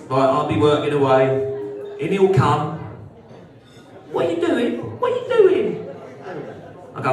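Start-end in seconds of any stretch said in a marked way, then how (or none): 4.1-5.9 put-on high whiny kid voice, much higher; pestering kid. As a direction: mimic a nagging child asking again and again.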